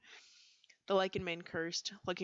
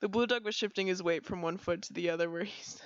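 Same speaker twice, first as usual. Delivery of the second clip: usual speaking voice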